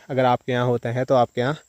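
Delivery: usual speaking voice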